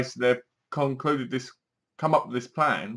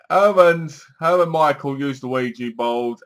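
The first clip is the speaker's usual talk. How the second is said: ordinary talk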